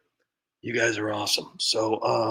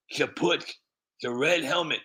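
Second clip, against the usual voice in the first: ordinary delivery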